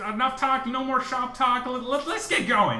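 high, loud cheery scout leader; rally the troop onward; scoutmaster voice. Acted. Scoutmaster to his scout, a high hearty rally.

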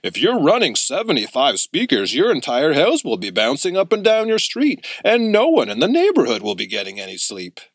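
a lightly put-on shop owner, matter-of-fact. A narrator's reading. Shop owner warning the boys about the speakers, lightly voiced. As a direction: warn the boys in a different, older voice.

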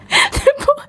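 high, slow, tearful laughing voice; crying with laughter. Real, not acted. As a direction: push the words out through helpless laughter-tears. Retelling while crying with laughter.

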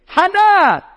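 high, loud imitation of a scream. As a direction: yell the name as the mother did.

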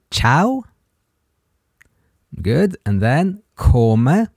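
slow, syllable by syllable, for learners; a teacher slowing down so a learner can copy; slow repeat-after-me. Shown as a demonstration. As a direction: spell the Italian out slowly for a student. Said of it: "try to repeat these three words".

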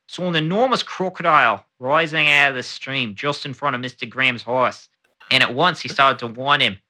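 measured formal read of a period newspaper report; reading an old account. Acted. Podcast host reads an old crocodile report aloud, formal read. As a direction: read it like an old colonial news item.

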